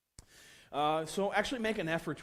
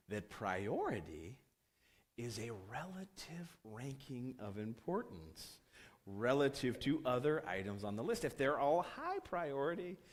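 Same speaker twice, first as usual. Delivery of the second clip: slow, over-careful explaining; patronizing; 3 semitones lower, 4 dB quieter, slower than usual. Retold or imitated. Developer explaining priority to the product owner, slow and patronizing. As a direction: spell it out slowly, talking down to them. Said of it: "I hate to sound patronizing".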